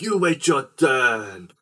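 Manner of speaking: drawn-out theatrical mock command; vampire on stage. Man on stage to the volunteering woman, slow mock-imperious. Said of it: "the guy's like".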